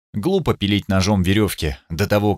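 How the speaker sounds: plain reading voice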